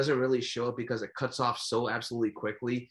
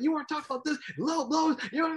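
angry Don King; faster than usual. Retold or imitated. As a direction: scold the commentator. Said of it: "he was like".

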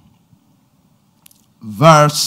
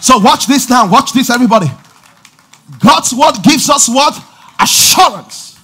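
fiery call and response sermon; preaching at full force; 8 semitones higher, 7 dB louder than usual. Acted. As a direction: drive the crowd with loud calls.

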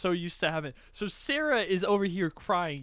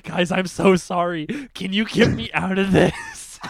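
loud, pretend begging for rescue; mock desperate plea; 8 dB louder than usual. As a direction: beg loudly, playing it up for laughs.

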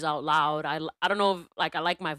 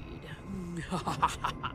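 evil storyteller chuckle; 9 dB quieter than usual. Acted. Closing a spooky tale of greed, soft evil chuckle. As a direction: chuckle darkly and quietly.

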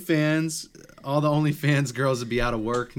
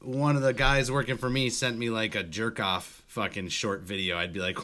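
usual speaking voice

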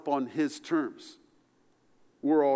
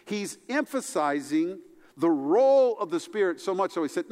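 ordinary talk

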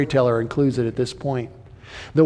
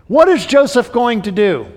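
raised, louder repeat of the question; emphatic question; 7 semitones higher, 6 dB louder than usual. Shown as a demonstration. Preacher to congregation, repeating the question louder. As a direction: press the question home to the congregation. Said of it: "preacher repeats the question louder".

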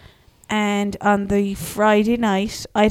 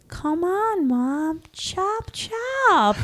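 high, sing-song sweet version of the order; changed tone; 8 semitones higher, much slower than usual. Retold or imitated. Son testing a sweeter tone on his mum, high and sing-song. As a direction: soften the bossy words into cute ones. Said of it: "just changed the tone of the same words".

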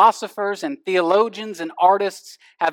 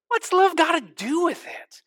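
higher, sassy [name] line; [name] impression; 9 semitones higher than usual. Pastor voicing [name]'s answer, pitched up and sassy. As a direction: toss the question up like Tina. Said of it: "[name] answered".

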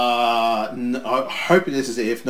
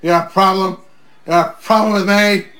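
louder challenging wrestler taunt; hammed-up wrestler menace; mock tough guy; 4 semitones higher, 5 dB louder than usual. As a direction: square up like a hothead. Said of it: "Like, yes".

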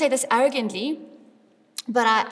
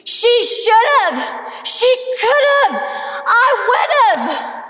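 mock frustrated yelling, much higher and louder; screaming at the TV; 9 semitones higher, 5 dB louder, slower than usual. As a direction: yell at the screen like a sore fan. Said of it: "everyone's screaming at the T V".

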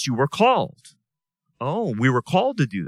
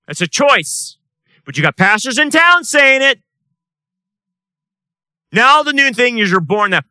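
loud, high, forceful sermon voice; fiery preaching; 7 semitones higher, 7 dB louder than usual. Acted. Pastor denouncing the town's pastors, loud preaching. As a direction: thunder it at the pews.

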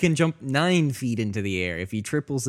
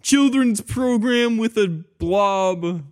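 put-on high, drawn-out children's program host; mock kids' show; 8 semitones higher, slower than usual. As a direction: put on a silly, sing-out TV host voice.

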